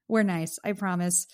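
ordinary talk

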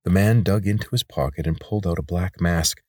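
ordinary delivery, nothing marked

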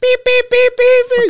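high, loud imitated beeping; piercing repeated horn beeps; car horn honks. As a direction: honk like a passing car. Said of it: "just honking and shit".

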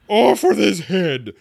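high, loud, drawn-out command; furious shout. A narrator's reading. Queen in a passion condemns players, shouting. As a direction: shout it in a royal rage. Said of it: "stamping about and shouting".

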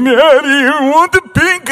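high-pitched imitation of a tantruming child; child's meltdown. Five-year-old begging dad at the ball machine, mock meltdown. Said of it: "implode, you know, like, just lose it".

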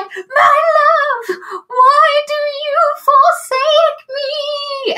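high, crying shout, dragged out slow; dramatic wail. Retold or imitated. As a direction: ham up a tragic lover's lament. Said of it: "dramatic effect".

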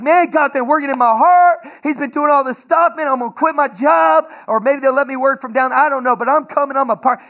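high, loud, eager friend's voice; excited retelling. Retold or imitated. Pastor voices excited friend's news.

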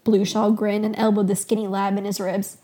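ordinary delivery, nothing marked

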